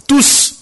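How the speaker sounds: "Tous" thrown out alone, pitched very high; emphatic exclamation. Real, not acted. Preacher insisting that every disciple fled, emphatic exclamation. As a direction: punch "Tous" as a sudden high outburst. Preacher hammers one repeated word.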